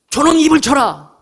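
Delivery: a sharp barked order to strike him; commanding order. Retold or imitated. High priest ordering guards to strike Paul, commanding bark.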